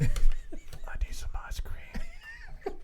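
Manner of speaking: very high, very slow, laughing whisper; Simple Joe again. Acted. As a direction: whisper it slowly like a simpleton, cracking up.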